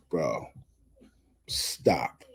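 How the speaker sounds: low, hushed plea; exasperated whisper. Real, not acted. Vlogger fed up with her argument, whispering in disbelief. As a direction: let it drop to a whisper. Exasperated at the paper bag claim.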